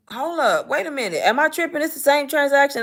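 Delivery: amazed. Retold or imitated. Retold checking email in disbelief.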